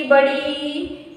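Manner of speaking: बड़ी stretched slowly, rising at the end; drawn-out long vowel. Shown as a demonstration. Teacher stretching बड़ी to show long matra. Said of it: "तो मतलब इसमें छोटी".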